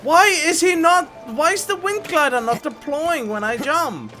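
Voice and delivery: high, loud frustrated complaint; exasperated yelling. Real, not acted. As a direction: shout at the game in disbelief. Game glider fails after jumping.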